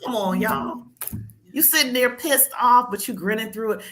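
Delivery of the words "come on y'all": normal talking voice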